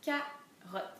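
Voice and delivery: CAROTTE broken into two syllables; découpé en syllabes. Shown as a demonstration. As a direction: pause between the syllables of "Carotte". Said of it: "Si j'enlève la syllabe rotte". (« Carotte » coupé en deux syllabes)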